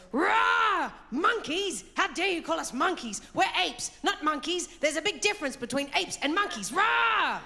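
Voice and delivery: high, loud indignant gorilla with roars; roar, then protest in high-pitched outrage; big gorilla. Retold or imitated. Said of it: "the big gorilla goes".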